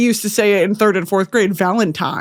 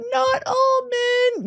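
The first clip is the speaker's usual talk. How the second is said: much higher, slow squealed whine; mock protest; 12 semitones higher, 4 dB quieter, slower than usual. Jokingly correcting herself about hating men, squealed. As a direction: whine it in a high mocking voice.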